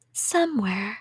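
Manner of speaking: ordinary talk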